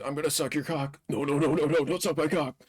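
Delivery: high, singsong cartoonish exchange; hammed-up high-pitched back-and-forth; mock movie dialogue. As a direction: play both parts in a silly high voice. Acting out mocked movie exchange, jokey voices.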